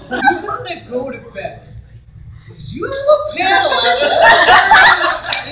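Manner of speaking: laughing, high mock nagging; mock concerned friend. Friend urging him to bed, mock concerned. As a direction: lightly play the worried friend. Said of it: "he was so concerned".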